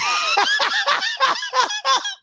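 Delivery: real high, rapid cackle; high cackling laugh. Real, not acted. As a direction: collapse into helpless high laughter. Chant collapses into laughter.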